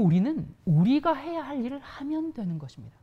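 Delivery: usual speaking voice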